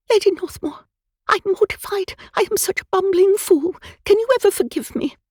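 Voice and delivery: a twitterer tumbling over her apology; flustered old woman. Acted. Bumped foreheads, near tears.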